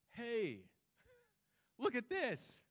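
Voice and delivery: high-pitched amazed call-out; surprised exclamation. Shown as a demonstration. Preacher shows the congregation how "behold" sounds today, surprised. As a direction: call it out as a startled discovery. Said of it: "used to indicate surprise".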